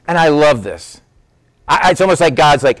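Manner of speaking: normal talking voice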